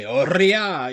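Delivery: loud barked Oriya! with a rolled R, Kansai thug style; Kansai tough guy. Retold or imitated. Streamer imitating a Yakuza thug's shout, loud Kansai bark. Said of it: "tough guys, like street thugs or Yakuza".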